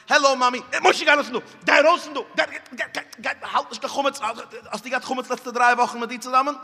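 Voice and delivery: put-on high, loud child chattering; a boy home from cheder, loud and high; child's voice. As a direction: play the excited little boy.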